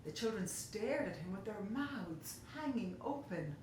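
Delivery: ordinary delivery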